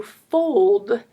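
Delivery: "fold" loud and slow, O drawn out; long O. Shown as a demonstration. Teacher pointing out the sound, loud stretched "fold" with long O. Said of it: "fold says O".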